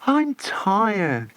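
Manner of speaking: high whiny tiredness; moaning tired. A narrator's reading. Luciane complaining to the others, tired whine. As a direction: whine it, exhausted. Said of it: "moaned Luciane".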